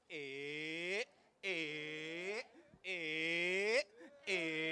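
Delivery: long drawn-out eh, rising a little; vowel sounds. Shown as a demonstration. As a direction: stir the cup, humming eh each turn. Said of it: "Make the vowel sounds".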